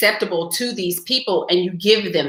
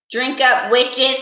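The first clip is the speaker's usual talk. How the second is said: cheeky toast, a bit higher; playful toast; 5 semitones higher, slower than usual. Real, not acted. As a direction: raise the cup and tease. Toasting viewers with her cup.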